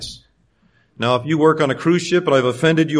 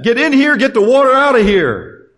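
much higher and louder urgent call; excited shout; 8 semitones higher, 5 dB louder than usual. Retold or imitated. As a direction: yell for help bailing water. Disciples' eager shouting.

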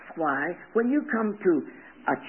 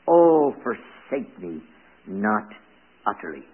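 prayerful reading; 4 semitones lower than usual. Acted. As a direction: pray the verses earnestly. Reads the psalm's prayer.

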